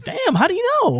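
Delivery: high, far-off mock surprise; mock amazement. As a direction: play dumb amazement in a put-on high voice. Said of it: "I was like".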